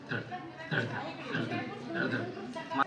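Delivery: breathy whispered thump sounds, like a pounding heart; soft unvoiced thud-thud of a racing heart; whispered heartbeat. Shown as a demonstration. Teacher imitates racing heartbeat.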